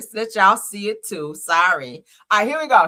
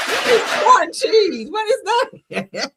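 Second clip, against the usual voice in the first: high, drawn-out disbelief; incredulous; 13 semitones higher, 8 dB louder, much slower than usual. Real, not acted. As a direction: stretch it out, high and loud, utterly baffled. Baffled reaction to a clip.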